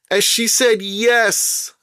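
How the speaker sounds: gleeful slow reveal; slow mock-astonished punchline. Real, not acted. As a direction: land the punchline slowly, incredulous. Punchline of the joke.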